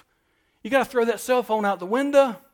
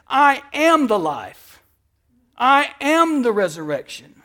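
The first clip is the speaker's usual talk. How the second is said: louder, slow, building preacher's proclamation; full-force preaching; 4 semitones higher, 6 dB louder, slower than usual. Acted. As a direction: thunder it out to the congregation. Proclaiming Jesus's words.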